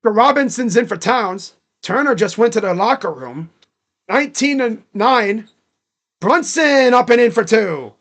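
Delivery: sportscaster tracking the action; live play-by-play. Real, not acted. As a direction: call the game as it happens. Calls the game live.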